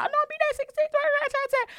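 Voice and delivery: high squeaky mock voice of the date; imitating the date. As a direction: mimic him in a silly high voice. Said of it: "And he's like".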